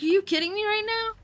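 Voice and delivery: high, disbelieving question; can't believe what you're seeing; incredulous. Retold or imitated. To the owl asleep among the cats, disbelieving.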